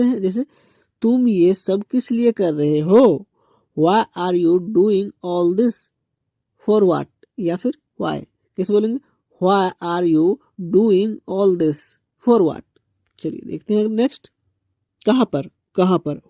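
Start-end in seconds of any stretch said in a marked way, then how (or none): 3.8-7.1 very slow and even, each word apart; slow model sentence. Shown as a demonstration. Teacher modelling the question for students, very slow. 9.5-12.6 very slow and even, each word apart; slow model sentence. Shown as a demonstration. Teacher modelling the question for students, very slow.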